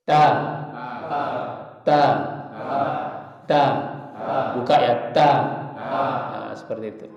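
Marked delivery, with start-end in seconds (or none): 0.1-3.6 ta with the tongue tip on the upper teeth; teacher modelling ta; demo of ta. Shown as a demonstration. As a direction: tap ta crisply, like a smile. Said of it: "perhatikan di kitab atau di slide-nya".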